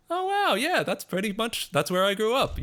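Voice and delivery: high-pitched mock amazement; impressed reaction. American friends reacting to quiz results, mock impressed.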